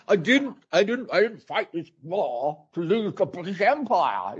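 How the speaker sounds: defiant Churchill rumble; hammed-up Churchill defiance; Churchill impression. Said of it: "I do a Churchill accent".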